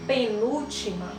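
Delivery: ordinary delivery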